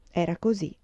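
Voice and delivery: ordinary delivery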